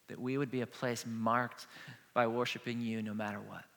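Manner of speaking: hushed prayer to end the sermon; closing prayer. Acted. Pastor closes in prayer.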